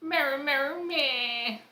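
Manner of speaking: very high, squeaky animal bleat; creature cry. Acted. Horse creature answers its partner with a high bleat. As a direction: bleat it like a tiny happy horse.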